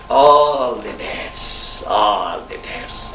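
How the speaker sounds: slow, low and drawn out; drawn-out repetition. Acted. Preacher dwelling slowly on the debts. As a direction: stretch the words for weight. Preacher repeating phrase for weight.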